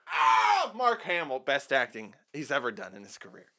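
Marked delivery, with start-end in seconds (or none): 0.1-0.7 a sharp, high, loud "Ah!" of pain; hammed-up scream of a wounded hero; mock pained cry. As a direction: act out Luke losing his hand. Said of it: "Luke says".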